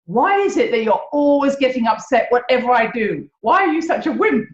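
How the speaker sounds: mock irritated outburst, building; exasperated retort. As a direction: finally say what you really think. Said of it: "really maybe we want to say is".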